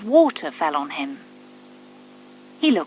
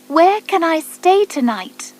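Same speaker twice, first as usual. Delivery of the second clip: high, bright bird voice, wondering aloud; a small lost bird asking himself; little bird voice; 9 semitones higher, 5 dB louder than usual. A narrator's reading. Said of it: "he thought".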